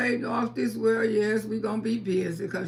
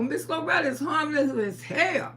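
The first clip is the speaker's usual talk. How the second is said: usual speaking voice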